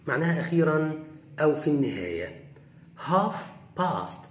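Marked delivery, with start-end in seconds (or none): 3.1-4.2 Half with no L sound, haf; half بدون نطق اللام; silent l. Shown as a demonstration. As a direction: drop the L in Half. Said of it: "ال L هنا silent".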